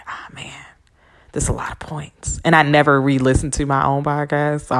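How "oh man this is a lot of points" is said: hushed inner worry; a private whisper of doubt; whispered thought. Retold or imitated. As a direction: whisper it to yourself. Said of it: "I was like".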